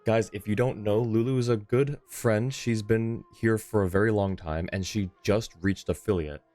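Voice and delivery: usual speaking voice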